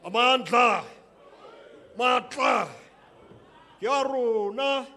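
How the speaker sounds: very loud, high rallying shout; full-throated freedom cry; rally call. Real, not acted. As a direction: call out to the crowd for the answer.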